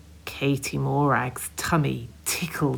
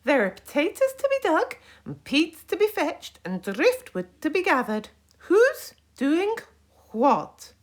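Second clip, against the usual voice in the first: put-on high, loud old granny voice; a loud bossy grandmother; 6 dB louder than usual. A narrator's reading. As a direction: bark orders at lazy children. Granny Island giving orders.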